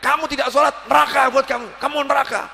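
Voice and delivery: preacher mocks harsh accuser with a yell. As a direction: shout the verdict at the sinner. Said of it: "yang satu teriak".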